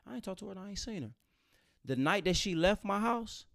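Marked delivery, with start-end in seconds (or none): 0.1-1.1 softer, higher nervous denial; denying guy. Retold or imitated. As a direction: deny it quietly and quickly. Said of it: "He like".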